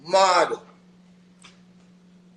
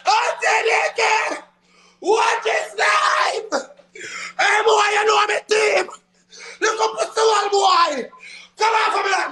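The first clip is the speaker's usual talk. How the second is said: triumphant yelling; 7 semitones higher than usual. Real, not acted. Streamer erupting at the opponent after winning, shouting. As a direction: roar it out after the win.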